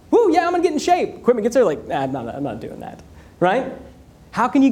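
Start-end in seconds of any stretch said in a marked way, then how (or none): 0.1-1.2 higher, pumped-up self quote; mock gung-ho cheer. Retelling his gym-buying enthusiasm. 1.9-3.0 much lower and softer, can't-be-bothered; lazy brush-off. Retold or imitated. Retelling losing interest after delivery.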